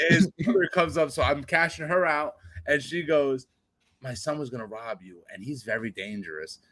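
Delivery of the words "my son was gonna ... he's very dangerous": quiet mock warning. Mother warns cashier.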